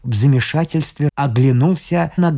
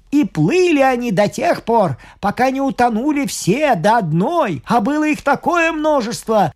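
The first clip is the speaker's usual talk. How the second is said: raised pitch, hurried excited telling; breathless village herdsman; shepherds' excited telling; 8 semitones higher than usual. Acted. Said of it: "Перебивая друг друга, рассказали".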